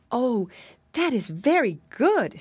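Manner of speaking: delighted exclamation, pitch swooping up; exclaiming. A narrator's reading. Indian maiden praising the jelly, exclaiming. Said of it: "she exclaimed".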